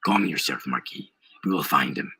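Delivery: very low, composed voice; calming companion. A narrator's reading. Cortez to a furious Marquis, calming low voice. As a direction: settle him down in a deep voice. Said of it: "Cortez said".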